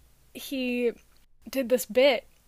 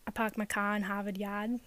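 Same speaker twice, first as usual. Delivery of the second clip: softer, low Boston accent with dropped Rs; 4 semitones lower, 7 dB quieter than usual. Shown as a demonstration. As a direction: recite it like a Bostonian. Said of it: "That's more Boston".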